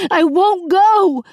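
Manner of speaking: high, loud, slow sobbing cry; sobbing refusal. A narrator's reading. Princess sobbing her refusal to the captain, high and loud. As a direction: sob it out with clenched fists. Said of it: "She sobbed and clenched her fists".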